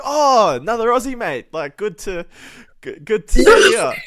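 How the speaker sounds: high, loud mock delight; excited greeting. Bloke greeting another Aussie, hammed-up excited welcome. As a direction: hail a fellow countryman, laughing at the end.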